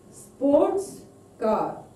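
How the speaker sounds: sports high, car falling; stress on SPORTS. Shown as a demonstration. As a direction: lift sports, then let car drop. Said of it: "stress is on first word".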